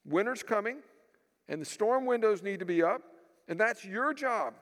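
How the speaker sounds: imitating a gruff, commanding father. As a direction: lay down the law like a stern father. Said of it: "in this really gruff voice".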